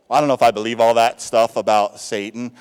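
lightly voiced sceptic; doubting churchgoer. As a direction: imitate a doubter shrugging off Satan. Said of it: "I've heard people say this".

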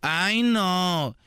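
high whiny brush-off; whiny teen back talk. Retold or imitated. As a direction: groan it off like a bratty teen. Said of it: "a los papás les contestan".

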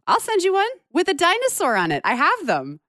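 high-pitched, thrilled offer; eager excitement. Real, not acted. Co-host offering to mail a dinosaur postcard, excited. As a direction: pipe up high, bubbling with eagerness.

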